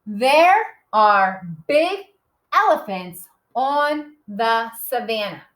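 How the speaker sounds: slow, word-by-word reading aloud; measured reading with pauses between words; read-along. Shown as a demonstration. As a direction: read slowly so children can follow. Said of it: "We're gonna read the whole thing".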